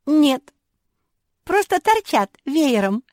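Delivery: very high, loud giggly reply; giggling. A narrator's reading. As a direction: toss it out as if tickled. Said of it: "хихикнула Куки". (звонко, будто хихикая)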